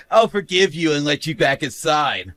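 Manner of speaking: normal talking voice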